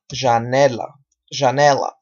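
"janela" as zha-NEH-la; Portuguese pronunciation. Shown as a demonstration. Teacher translates ventana for learners, Portuguese pronunciation. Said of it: "en portugués decimos".

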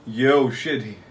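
normal talking voice

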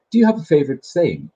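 put-on the boy in the book asks the mole; boy's voice. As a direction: read it as the curious little boy. Reading the boy's lines aloud.

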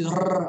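trilled "rrr"; rolled R sound. Shown as a demonstration. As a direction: roll the tongue on a lone R. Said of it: "som de R". (som de R vibrado)

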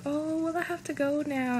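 put-on high voice; imitated excuse. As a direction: imitate her making a high, drawn excuse to go. Acts out her excuse to leave.

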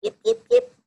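high sharp yips; high fox yipping. Pretend. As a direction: yip like a little fox. Fox goodnight sound.

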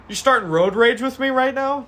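mock-offended road rage cry; indignant outburst. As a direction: turn the tables on the cops. Driver escalates at cops.

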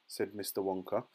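usual speaking voice

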